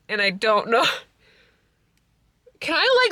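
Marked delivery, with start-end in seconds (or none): 2.6-3.1 giddy squeal. Real, not acted. Giddy overwhelmed.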